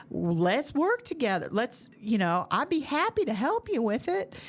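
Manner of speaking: hammed-up Southern gentleman voice; Southern drawl impression. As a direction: imitate the friend with a sweet Southern drawl. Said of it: "terrible impression of Mark".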